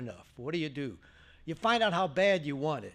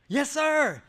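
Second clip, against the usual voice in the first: higher, louder eager yes sir; 10 semitones higher, 8 dB louder than usual. Retold or imitated. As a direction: snap it out, thrilled to be asked. Eagerly accepting the fill-in job.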